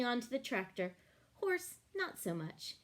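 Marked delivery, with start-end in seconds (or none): none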